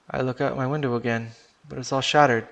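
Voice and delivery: plain reading voice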